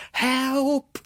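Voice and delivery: high, loud desperate cry for help; soul-piercing cry. Acted. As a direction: scream it from the top of the stairs. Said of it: "I heard a soul-piercing cry".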